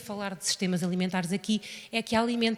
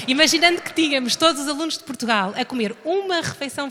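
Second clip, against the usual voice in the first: higher and louder, just after laughing; amused restart; 4 semitones higher, 7 dB louder than usual. Real, not acted. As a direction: pick it back up through real amusement. Restarts loudly after laughing fit. (retomando alto, ainda divertida)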